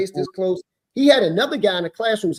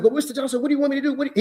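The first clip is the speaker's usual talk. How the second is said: high-pitched imitation of a pleading man; mock a cornered teacher pleading; higher, panicky mock plea; flustered teacher; 8 semitones higher, much faster than usual.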